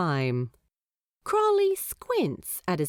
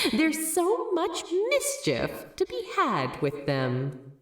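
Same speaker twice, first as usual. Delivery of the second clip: higher, gleeful scheming; sly demon voice; 11 semitones higher than usual. Acted. Demon gloating to himself, gleeful. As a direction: relish the mischief ahead.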